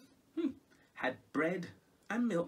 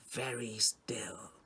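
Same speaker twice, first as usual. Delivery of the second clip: hushed; 6 semitones lower, slower than usual. A narrator's reading. Storyteller whispers about the still cat.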